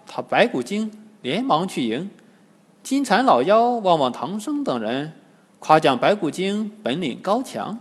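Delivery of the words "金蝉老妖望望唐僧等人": ordinary delivery, nothing marked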